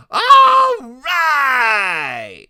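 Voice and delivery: long, high, full-voiced whoop; exultant shout. A narrator's reading. Freak up on shoulders at the fireworks, shouting high and long. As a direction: shout it out, stretching the words high. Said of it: "And Freak is shouting".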